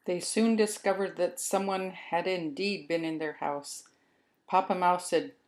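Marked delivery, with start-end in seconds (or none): none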